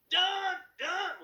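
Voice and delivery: high shouted rock yell. Shown as a demonstration. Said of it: "channeling my best [name] impression".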